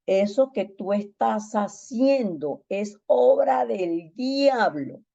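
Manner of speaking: slow, weighty rebuke; measured stern scolding. Retold or imitated. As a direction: lay down each word like a verdict. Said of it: "yo le hablé muy fuerte a la hermana".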